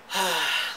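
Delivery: real, audible sigh before explaining; heavy exhale of exasperation; exasperated sigh. Real, not acted. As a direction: let out a weary sigh. Sighs before recalling a stupid episode.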